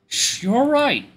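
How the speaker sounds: high, slow hushing voice; warm, soft comforting. Acted. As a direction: hush them like a father comforting a child. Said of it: "His voice is so warm and soft".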